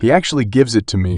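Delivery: ordinary delivery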